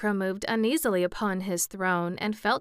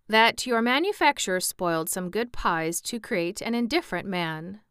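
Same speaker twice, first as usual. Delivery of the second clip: usual speaking voice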